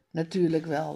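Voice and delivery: usual speaking voice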